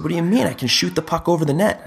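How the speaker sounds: put-on high, indignant kid voice, pitched well up; imitating young son. Dad imitates his son questioning him, in a high indignant kid voice. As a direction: protest like a puzzled little boy.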